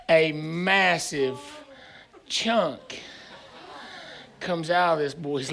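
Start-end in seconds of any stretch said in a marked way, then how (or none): none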